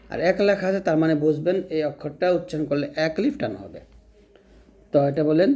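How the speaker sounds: ordinary talk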